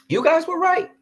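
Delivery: sarcastic falsetto concession; high mocking squeak. Shown as a demonstration.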